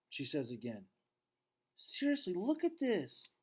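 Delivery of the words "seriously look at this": put-on much higher young daughter insisting; girl's voice. Julia urges her dad to look, high girl voice. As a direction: pitch up into a girl pleading.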